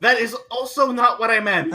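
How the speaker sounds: high-pitched comic protest; flustered correction. Acted. Kenneth returns to correct the innuendo.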